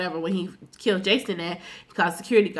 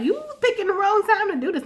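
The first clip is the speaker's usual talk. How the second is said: incredulous mock warning; 11 semitones higher, faster than usual. Warning Jamie in mock quote.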